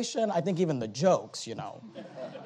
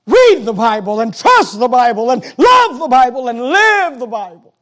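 high, loud, slow hammering list; shouted sermon refrain; preacher's shout; 7 semitones higher, 16 dB louder, slower than usual. Acted. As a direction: pound each phrase like a drumbeat.

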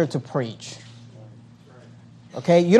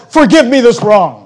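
louder, emphatic mock plea for forgiveness; sarcastic; 3 semitones higher, 5 dB louder than usual. Preacher quotes Paul's feigned apology to the church, sarcastic. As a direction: ask pardon without meaning a word of it. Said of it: "you can really tell his sarcasm".

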